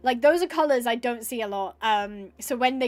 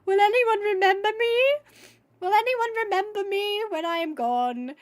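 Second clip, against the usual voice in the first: mock self-pity; 7 semitones higher than usual. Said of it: "there I was being like".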